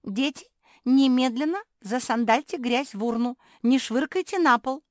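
high, loud scolding parent's order; Papa Charlie. Acted. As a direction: mimic a father loudly ordering children. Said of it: "папа Чарли, говорит".

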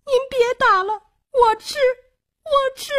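put-on very high, crying little girl; tearful begging. A narrator's reading. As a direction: sob out a surrender in a tiny high child's voice. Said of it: "流着泪捧起地下的饭说". (小女孩带哭腔尖细地哀求)

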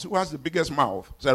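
ordinary talk, nothing marked

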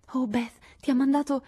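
voce alta e acuta, piena di slancio; eccitata. A narrator's reading. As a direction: parte di corsa, tutta agitata. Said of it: "gesticolando con sconveniente energia". (sorella entusiasta che annuncia la lettera, acuta e concitata)

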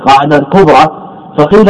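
ordinary talk, nothing marked

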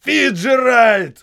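протяжный громкий высокий зов; заорать на весь лес; крик. A narrator's reading. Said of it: "заорал Моррис". (капитан орёт, зовя лейтенанта)

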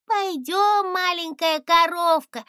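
put-on high, sweet little girl. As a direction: speak like a small kind girl. Little girl comforts dragon.

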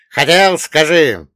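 громко, растягивая «Хотел»; давит словом «Хотел»; настойчиво и громко. Acted. Злодей требует признания. (громко требует «Хотел»)

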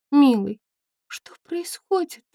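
high, bewildered whisper; confused whisper. Acted. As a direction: whisper it, lost and frightened. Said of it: "смущённо спросила она".

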